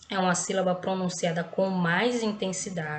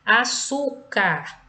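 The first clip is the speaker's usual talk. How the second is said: "Açúcar" very slowly, loud on ÇÚ; teacher's drawn-out "Açúcar", weight on the middle; stress on penultimate syllable; 2 semitones higher, 5 dB louder, much slower than usual. Shown as a demonstration. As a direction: break "Açúcar" into syllables and push ÇÚ. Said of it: "pronunciada com mais intensidade".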